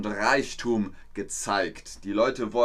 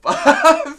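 sudden loud, high laugh; real high-pitched burst of laughter; 13 dB louder than usual. Real, not acted. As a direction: burst out laughing mid-word. Bursts out laughing mid-reading.

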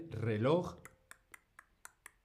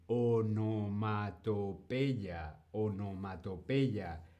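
slow syllabled word; 3 semitones lower, slower than usual. Shown as a demonstration. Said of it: "qué palabra tan difícil".

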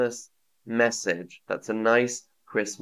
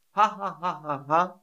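higher-pitched, spoken-out ha-ha laugh; mock Santa laugh; 5 semitones higher than usual. Shown as a demonstration. Teacher offering learners a guess at Santa's sound, mock laughing. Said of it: "Santa laughing?".

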